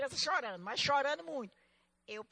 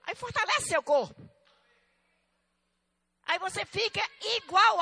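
loud, raised preacher's voice; full-force preaching; 7 semitones higher, 13 dB louder than usual. Real, not acted. Preacher tells believers the Spirit carries them, full-force preaching. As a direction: drive it out to the whole congregation.

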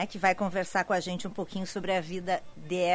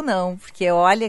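normal talking voice

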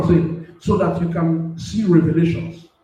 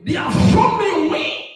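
preacher's shouted repeat; 12 semitones higher than usual. Acted. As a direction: shout it back at the congregation, stressing shown. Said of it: "Because they have shown me way".

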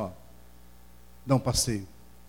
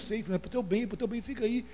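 tender father; 9 semitones higher than usual. Retold or imitated. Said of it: "Eu falei".

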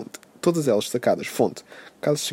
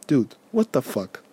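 ordinary talk

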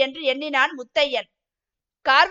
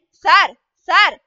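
desperate crying out; 3 semitones higher, 7 dB louder, slower than usual. A narrator's reading. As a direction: beg through the locked door, loud and drawn out. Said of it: "கதறிக்கொண்டே".

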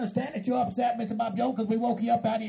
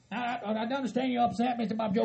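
usual speaking voice

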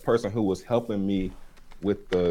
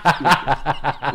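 put-on Toucan in a laughing Jamaican accent, higher and louder; 6 semitones higher, 8 dB louder than usual. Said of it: "he has a Jamaican accent".

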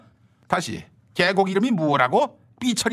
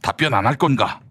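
low stern minister; 13 semitones lower than usual. Acted. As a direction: press him like a cold interrogator. Low voiced rival character.

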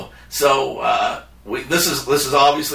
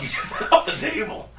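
breathy laugh through the accusation; 10 semitones higher, 6 dB quieter than usual. Real, not acted. Retelling the stolen book, laughing through the words. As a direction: laugh it out half-whispered.

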